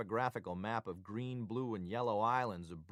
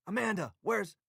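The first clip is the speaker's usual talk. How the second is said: high, loud call cut short; alarmed cry; 12 semitones higher, 7 dB louder than usual. A narrator's reading. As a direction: cry out as if jolting awake. Wakes and bolts upright calling out.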